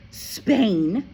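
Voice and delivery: put-on a child's horrified echo; dismayed girl. A narrator's reading. Matilda hears they are moving to Spain, dismayed. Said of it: "Matilda cried".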